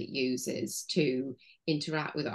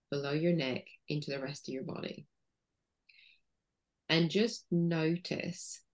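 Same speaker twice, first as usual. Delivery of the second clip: guided body scan; 3 semitones lower, 4 dB quieter than usual. Shown as a demonstration. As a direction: lead the listener gently inward, unhurried. Guides listeners through body exercise.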